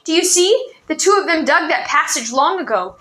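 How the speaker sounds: panted out story. A narrator's reading. Boy panting out his story to the old man. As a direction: pant it out in a hurry. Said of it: "Ranifer panted out his story".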